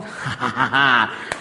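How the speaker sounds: low soft chuckle. Real, not acted. Preacher laughing at his joke about the husband, low chuckle. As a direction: laugh quietly at your own joke.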